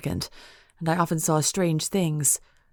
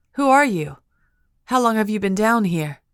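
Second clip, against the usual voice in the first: high, louder urgent call; 5 semitones higher, 5 dB louder than usual. A narrator's reading. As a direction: call through the bars. Heroine calling to stranger.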